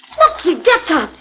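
high, loud urgent plea; desperate call. Acted. Countess shouts at the unconscious Smokey, desperate and loud. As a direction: shout at him to wake up.

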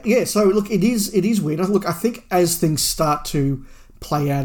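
ordinary talk, nothing marked

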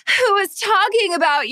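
high eager fan question. Retold or imitated. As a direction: ask it like a thrilled fan courtside. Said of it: "I should have been like".

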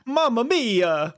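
high, slow Italian plumber exclamation; Mario. Acted. Cohost delivers a cheesy joke punchline as Mario, high and drawn out. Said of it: "what is Mario's favorite movie".

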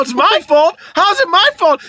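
high, loud retold disbelief; hammed-up incredulous shout; incredulous protest. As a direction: yell it back as an outraged question. Retold argument with upset son.

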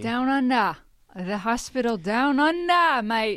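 a hammed-up Australian accent; lightly put-on Australian accent; mock Australian. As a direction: drawl it like a cartoon Aussie.

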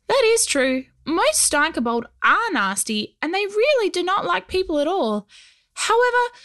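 high, small goblin voice; tiny gravelly voice. Acted. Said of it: "his gravelly little voice".

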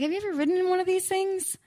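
put-on high, quick, half-hearted Australian accent; attempted Australian accent. Singer quoting tourmate's question, half-hearted Australian accent. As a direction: imitate an Australian, badly and brightly. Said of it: "I can't do an Australian accent".

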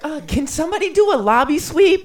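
very high squeaky boss voice; squeaky manager voice. Retold or imitated. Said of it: "high, squeaky voice".

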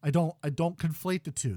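ordinary delivery

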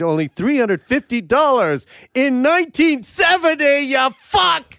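high, hammed-up sponsor pitch; comic pitchman blowing up; mock ad shout. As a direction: turn the ad read into a yell. Mock sponsor read erupts into shouting.

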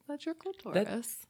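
a hint of a high, patient mom voice; mock patient mom voice. Mom repeating the answer to her toddler, high and lightly put on. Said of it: "And I said".

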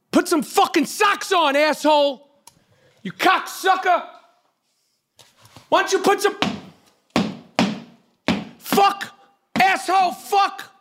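screaming up at the noisy neighbors, pitch way up; yelling at the ceiling. Real, not acted. Yelling up at the stomping upstairs neighbors, high furious rant.

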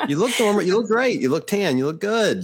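high, bright hype-up praise; excited compliments. Real, not acted. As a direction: gush over her look. Hyping up a friend's look.

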